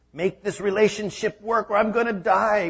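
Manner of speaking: high, whiny pleading; hammed-up whining prayer; mock desperate plea. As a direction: beg God dramatically.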